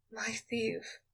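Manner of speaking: soft, sad and hushed; sadly. A narrator's reading. Queen answers her wounded thief, softly sad. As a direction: let it fall sadly. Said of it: "she said sadly".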